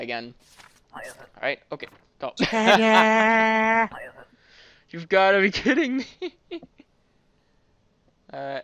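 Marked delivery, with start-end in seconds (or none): 2.4-4.2 loud, high burst of laughter. Real, not acted. Reacting to an absurd entrant. 4.9-6.6 loud, high, through laughter; laughing disbelief. Real, not acted. Laughing at absurd passport.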